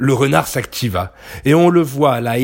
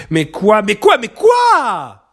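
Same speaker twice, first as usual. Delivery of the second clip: high, loud shout building to a long wail; exasperated howl; 9 semitones higher, 5 dB louder, slower than usual. A narrator's reading. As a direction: yell it, stretching the last word. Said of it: "hurla le renard".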